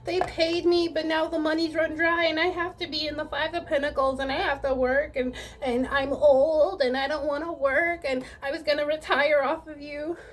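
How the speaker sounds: playing victim. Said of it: "a person that's playing victim".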